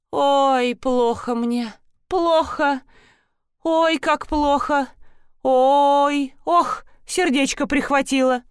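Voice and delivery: театрально стонет, будто плохо с сердцем; притворный сердечный приступ, жалобные охи; изображает сердечный приступ. Acted. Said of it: "демонстративно оседая на землю". (наигранно хватается за сердце, охая)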